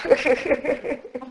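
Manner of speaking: high, soft giggling laugh; real giggle. Real, not acted. Meditation leader amused at waking the group, high soft giggle. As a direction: giggle at your own joke.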